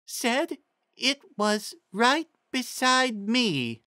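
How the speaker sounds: high, slow, simple-minded pony voice; high slow Ditzy. A narrator's reading. Ditzy reading Trixie's riddle to the group, high and slow. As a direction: drag it out high and dopey.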